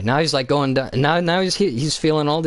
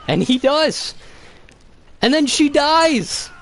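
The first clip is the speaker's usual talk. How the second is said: building excitement; 9 semitones higher than usual. Real, not acted. Fan retelling Anakin's massacre, rising high excited telling. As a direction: pump it up as the climax hits.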